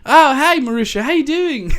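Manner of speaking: high, bright dad greeting, laughing; playing Bluey's dad; cheery dad. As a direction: breeze in like a chipper cartoon dad. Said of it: "dad just comes walking in and goes like".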